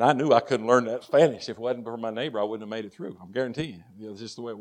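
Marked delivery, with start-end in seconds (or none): none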